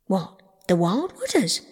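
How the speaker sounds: aghast question; trembling cry. A narrator's reading. Toad hears of the Wild Wooders from Rat, frightened cry. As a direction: react with dawning dread. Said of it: "cried Toad, trembling in his every limb".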